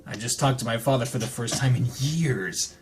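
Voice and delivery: calm, even read-aloud narration; story narration. Acted. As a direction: read the novel text evenly, like an audiobook. Reads visual novel narration.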